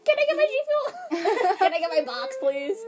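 high, whiny begging voice, laughing; high whiny plea. Retold or imitated. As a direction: beg in a squeaky high voice. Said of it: "and be like".

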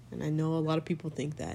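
ordinary delivery

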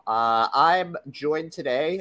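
ordinary talk

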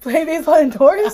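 loud and high disbelief; loud incredulous question. Real, not acted. Friend blurting back at the indoor baseball idea, loud and incredulous.

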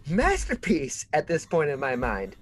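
high, half-laughing mock outrage; mock indignant complaint. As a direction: ham up being wronged, laughing as you go. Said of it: "And I'm going".

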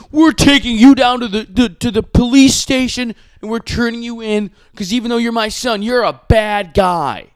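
high, loud sanctimonious parent voice; mock righteous parent. Podcaster mocking sanctimonious parents, high and loud. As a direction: parody parents turning in their son.

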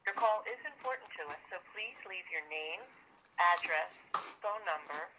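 automated phone greeting over the line; recorded message heard through the phone; phone menu voice. Real, not acted. Recorded customer service greeting.

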